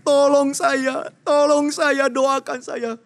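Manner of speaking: high, loud, slow desperate begging; tearful pleading. Acted. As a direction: beg through tears. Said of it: "dia terus memohon".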